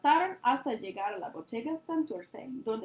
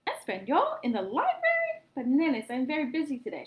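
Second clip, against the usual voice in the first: surprised aunt; 4 semitones higher, 6 dB louder than usual. A narrator's reading. Aunt surprised, then declines.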